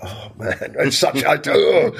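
high, dragged-out exasperated groan; retold exasperation. Owner hearing napkins ran out, mock exasperated moan. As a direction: act out despairing at the news.

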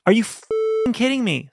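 incredulous outrage. Retold or imitated. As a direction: stretch it out in mock disbelief. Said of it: "I'm like".

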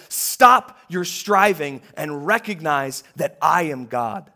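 God rebuking the warring nations; stern command. Acted. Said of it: "he says to them".

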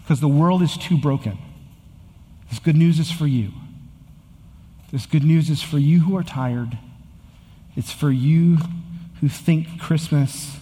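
rising, urgent sermon refrain; impassioned preacher driving the refrain home; preaching at full force. Acted. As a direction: preach it out, building each line.

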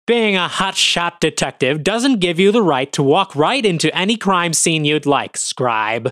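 hostile officer. Acted. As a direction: put the journalist in his place. Hostile officer berates detective.

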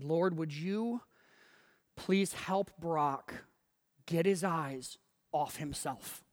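slow, earnest mock prayer; measured prayer with a deadpan punchline; retold prayer. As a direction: pray it slowly, pausing between phrases. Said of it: "can I pray for you".

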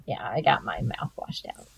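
whispered confession. Real, not acted. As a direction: drop to a whisper for the punchline. Whispered at story end.

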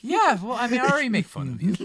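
high, fast playful jab; teasing. Pretend. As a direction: tease him brightly. Teasing co-host.

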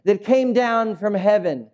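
high, insistent explanation; Jesus straining to explain. Acted. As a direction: press each phrase so they get it. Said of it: "he's searching for a way to".

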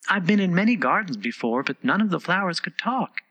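put-on high, polite girl's voice; complimenting Alice. A narrator's reading. Alice hopes to improve its temper, high and polite. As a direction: flatter them in a high voice.

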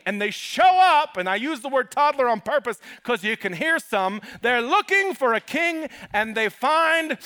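high, loud building preaching. Acted. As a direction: build the suspense toward the reveal. Building sermon climax.

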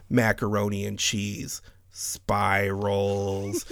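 spirals drawn out long and low; pause, then hold the end of spirals; low mock coworker, spirals stretched out; stretched last word. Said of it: "It had to be this speed".

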